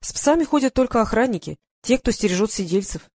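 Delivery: plain reading voice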